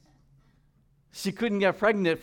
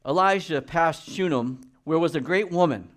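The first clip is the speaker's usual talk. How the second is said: scripture reading; 3 semitones higher, 6 dB louder than usual. Acted.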